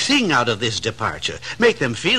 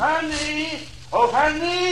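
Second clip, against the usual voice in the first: calling high up the stairs, raised pitch; 10 semitones higher, 4 dB quieter, slower than usual. Acted. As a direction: call for your wife from another room. Husband calls wife from afar.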